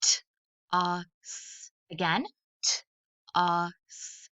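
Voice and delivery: t, a, s given as separate sounds; sounds tapped out. Shown as a demonstration. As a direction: tap out each sound of toss. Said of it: "Let's tap out the word".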